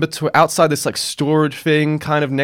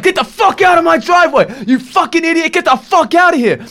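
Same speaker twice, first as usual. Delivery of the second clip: much higher, louder imitated screaming man; furious shout; 12 semitones higher, 3 dB louder than usual. Enraged man screaming at the delivery driver to leave, furious shout. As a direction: shriek the insults like a man losing it.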